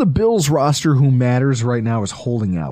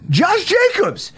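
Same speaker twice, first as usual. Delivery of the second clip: very high-pitched, drawn-out exclamation; incredulous outburst; slower than usual. Real, not acted. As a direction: squeal the name in disbelief. Repeats the name in mock disbelief.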